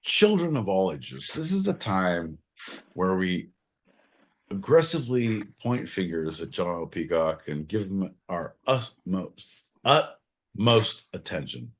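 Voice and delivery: low, measured announcer address; mock ringmaster. Host presenting John to the audience, mock-solemn announcer. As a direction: announce it low, soft and stately.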